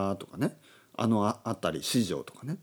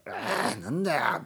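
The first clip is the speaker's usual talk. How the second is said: exasperated groan into an acted, higher-pitched complaint; mock angry outburst; 6 semitones higher than usual. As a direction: act out being fed up, pitched up but not shouted. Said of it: "とにかく怒る".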